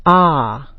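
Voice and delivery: long open "Ah"; dropped-jaw ah. Shown as a demonstration. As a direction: drop the jaw wide on "Ah". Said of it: "Drop your jaw and say ah".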